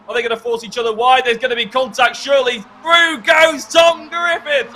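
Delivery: rising to a loud shout; building excited shout. Real, not acted. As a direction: build the call to a roar. Live commentary on contact and pass.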